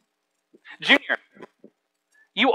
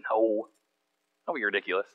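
much softer, lower brush-off; hushed dismissal; 8 semitones lower, 10 dB quieter than usual. Retold or imitated. Dad quietly reassuring his son, low and soft. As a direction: wave it off quietly. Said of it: "I said".

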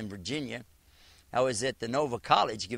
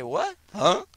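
surprised; 6 semitones higher, 6 dB louder than usual. Retold or imitated. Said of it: "They didn't expect that".

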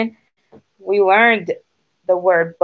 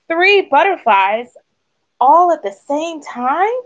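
higher, stretched-out wonder; amazed duck; 8 semitones higher than usual. A narrator's reading. Duck marvels to Goose at Thistle, amazed. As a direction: marvel at it in a high, drawn-out voice. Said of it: "Duck quacked".

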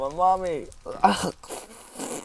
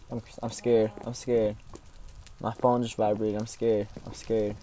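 ordinary delivery

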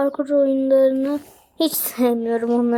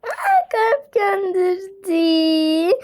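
slow high delighted surprise rising to a squeal; gasp, then squeal; surprised gasp; 5 semitones higher, much slower than usual. Real, not acted. Player sent her a heart, delighted surprised squeal.

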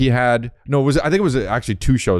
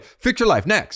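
higher, rushed mock dismissal; brisk mock therapist; 9 semitones higher, slower than usual. Host mocking a careless advice show, brisk and higher. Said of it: "It wasn't like we were like".

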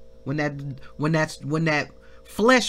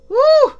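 high, loud whoop of praise; joyful shout; 13 dB louder than usual. Real, not acted. Preacher whooping in praise to the congregation. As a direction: let out a loud church whoop.

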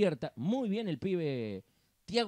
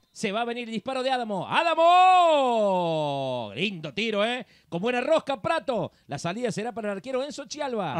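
loud, long-held name on the shot, then lively commentary; excited play-by-play; 5 semitones higher, 8 dB louder than usual. Real, not acted.

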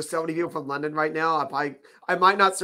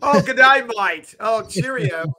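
loud, higher, hammed-up Aussie-British greeting; mock Aussie-British accent; 6 semitones higher, 7 dB louder, slower than usual.

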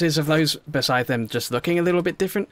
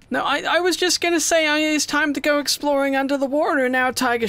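panicked squeak; 13 semitones higher than usual. Real, not acted. Shark appears mid-sentence.

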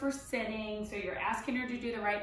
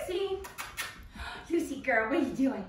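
exciting greeting; faster than usual. Shown as a demonstration. Trainer practicing an exciting greeting, squeal and gasp. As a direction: pump the dog up on purpose. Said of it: "practice exciting greeting".

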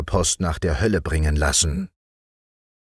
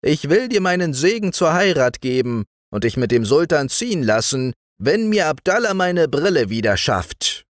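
put-on solemn, raised old woman's voice; grave old grandmother; 9 semitones higher than usual. A narrator's reading. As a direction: speak with great earnest as the grandmother. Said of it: "sagte mit großem Ernst".

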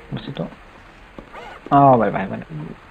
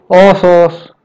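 startled exclaim; 3 semitones higher, 6 dB louder, much slower than usual. Real, not acted. House full of wild animals.

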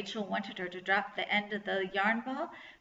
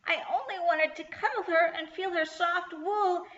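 high, plaintive goblin voice; sad voice; 9 semitones higher than usual. A narrator's reading. Goblin sunk down on the grass, sad voice. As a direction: plead sadly in a high voice. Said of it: "he said in a sad voice".

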